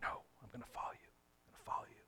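fast, hushed whisper; whispered insistence. Acted. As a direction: insist urgently under the breath. Peter insisting, imagined.

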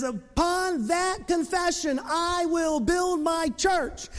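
high, slow, drawn-out proclamation; grand declaration. Acted. Preacher voices Jesus declaring over Peter, grand declaration. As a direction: proclaim it slowly with a lifted pitch.

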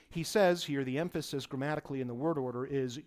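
ordinary talk, nothing marked

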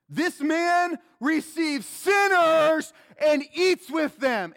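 slow, high, loud public accusation; cry out. Acted. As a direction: denounce him to the whole crowd. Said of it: "They literally cry this out over the crowd".

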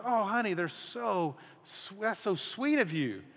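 softly pleased wife touched by the flowers; wife's delighted reply. Retold or imitated. Wife voiced answering door.

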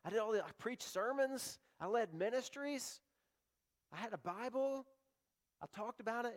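high, pleading voice of a desperate man; a high, whiny plea at judgment day; pleading churchgoer. Retold or imitated. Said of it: "They're gonna say".

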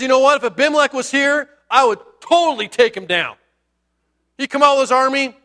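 pumped-up mock challenge; mouthy boasting. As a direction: brag like a party big talker. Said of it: "starts getting a little mouthy".

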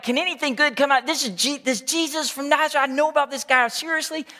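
high, scoffing and incredulous; mocking disbelief. Nathanael scoffing to Philip, high mocking disbelief. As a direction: scoff at the very idea of Nazareth. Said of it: "he's joking".

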